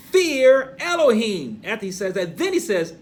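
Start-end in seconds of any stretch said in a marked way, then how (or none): 0.1-1.6 high, loud, drawn-out proclamation; booming scriptural order; emphatic command. Acted. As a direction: thunder it out slowly from the pulpit. Quotes the doubly emphasized command.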